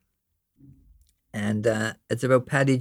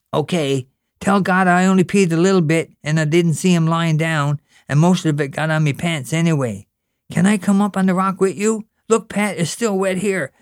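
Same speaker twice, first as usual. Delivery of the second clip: put-on higher, eager small boy; little brother Gene; 6 semitones higher, 5 dB louder than usual. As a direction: pester your big brother, curious. Gene confessing, pestering.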